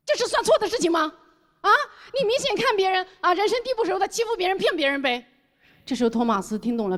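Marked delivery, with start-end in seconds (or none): 0.1-5.2 higher-pitched, scolding accusation; indignant confrontation. Retold or imitated. Customer accusing the boss of bullying a foreigner, indignant. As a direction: call out the cheat, pitch raised.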